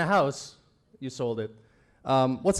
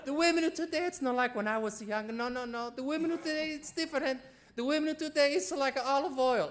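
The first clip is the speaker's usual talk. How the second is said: Italian mom's advice; 9 semitones higher, 6 dB quieter than usual. Retold or imitated. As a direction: lecture like an old Italian mama. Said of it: "my Italian mom gave me".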